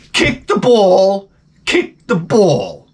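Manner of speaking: slow, stretched-out parent holler; hammed-up soccer parent calling out; mock sideline screaming. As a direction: imitate a parent yelling directions from the sideline. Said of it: "start screaming directions at them".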